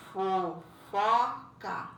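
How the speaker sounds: fofoca said very slowly as fo-fo-ca; sounding out syllables. Shown as a demonstration. Teacher counts mouth movements in fofoca, slowly. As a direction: stretch fofoca into slow separate syllables. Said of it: "Quantas vezes você movimenta a boca".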